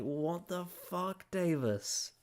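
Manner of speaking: high, slow disbelief aimed at Davis; mock outrage. Mock-scolding Davis over his take, high and slow. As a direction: pitch it way up in mock exasperation. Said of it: "I'm like".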